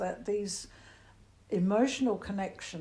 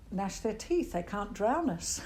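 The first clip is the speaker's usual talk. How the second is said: normal talking voice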